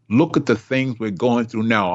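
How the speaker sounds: ordinary talk, nothing marked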